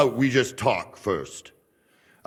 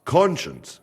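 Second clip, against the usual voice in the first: ordinary talk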